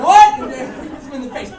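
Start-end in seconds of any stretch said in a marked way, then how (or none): 0.0-0.4 very loud, high rising yelp; outraged disbelief. Retold or imitated. Skinhead balks at Dave's order, outraged yelp. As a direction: act out shocked indignation at the demand.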